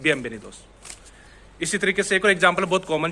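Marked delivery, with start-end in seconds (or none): 0.0-0.6 बिएनवेनिदोस said as biembenidos, n closed to m; n becomes m. Shown as a demonstration. Teacher shows the native Spanish way, n becomes m. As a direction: soften the n of बिएनवेनिदोस into m. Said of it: "बिएनवेनिदोस नहीं बोल रहा हूँ".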